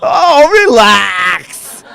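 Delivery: slow, loud laugh through the words; cracking up at his own witch joke; laughing loudly. Real, not acted. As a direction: laugh it out, barely able to speak.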